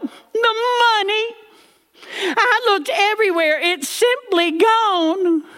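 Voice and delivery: high, breaking cry of panic; mock-sob in a high voice; granny crying out. Granny crying out about the lost money, high and teary. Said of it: "And Granny cried out".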